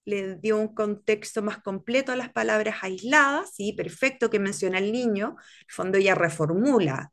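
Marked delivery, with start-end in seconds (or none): none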